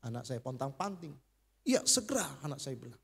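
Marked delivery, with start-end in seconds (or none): 1.6-2.2 quick, high, loud eager reply; urgent reply. Retold or imitated. Son hurrying to answer the nurse, urgent and high. Son rushing to hospital staff.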